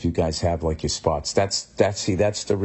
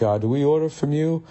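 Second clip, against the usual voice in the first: high, rising mock repeat; mocking echo; 6 semitones higher than usual. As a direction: parrot the question back, pitched up. Mocking mimicry of a question.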